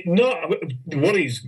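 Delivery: normal talking voice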